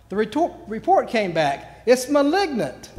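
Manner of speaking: loud, high-pitched public shout. Acted. Bad medical news nobody shouts, shouted out loud. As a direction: build to a high, ringing yell. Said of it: "I've never been able to shout".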